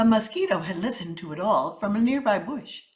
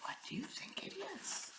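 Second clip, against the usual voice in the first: whisper; 7 semitones higher, 19 dB quieter than usual. Shown as a demonstration. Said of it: "whispered question to listeners".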